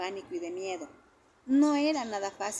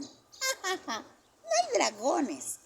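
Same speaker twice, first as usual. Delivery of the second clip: mocking child laugh; 9 semitones higher, 3 dB louder, much slower than usual. A narrator's reading.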